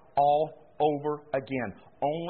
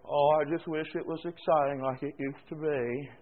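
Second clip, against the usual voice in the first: mock moping whine; 3 semitones lower, 4 dB quieter than usual. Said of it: "you go around moping and groaning".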